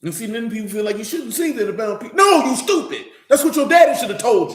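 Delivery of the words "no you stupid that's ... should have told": high loud retort with a laugh in it; laughing loud insist. Acted. Preacher answering people who object, laughing loud retort. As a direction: laugh at the objection and shout it back.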